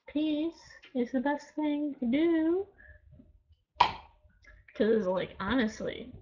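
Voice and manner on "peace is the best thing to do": dreamy peace. Real, not acted. Preaching niceness to haters.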